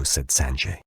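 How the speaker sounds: usual speaking voice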